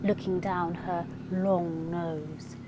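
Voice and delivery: plain reading voice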